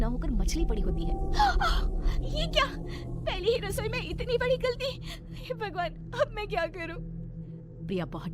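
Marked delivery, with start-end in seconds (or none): 1.2-2.2 loud, high horrified gasp; shocked gasp. Acted. Priya seeing fish instead of roti, horrified gasp. 2.2-7.2 put-on very high, distraught young bahu; panicked dismay. Priya facing a disaster in her first kitchen, panicked.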